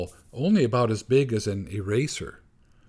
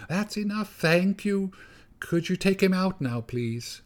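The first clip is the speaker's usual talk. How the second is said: mother mouse voice; 8 semitones higher than usual. A narrator's reading. Mother mouse begs Gorsh to cure her child, high pleading voice. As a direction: beg like a tiny anxious mother mouse.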